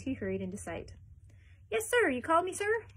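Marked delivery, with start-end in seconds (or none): 1.7-2.8 high, anxious kennelman; high-pitched servile kennelman; eager servant. A narrator's reading. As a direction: rush in eager to please. Kennelman answers the Duke.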